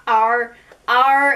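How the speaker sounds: "our" said like the letter R; "our" flattened to sound like the name of the letter R. Shown as a demonstration. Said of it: "like the letter R instead of O R, O U R".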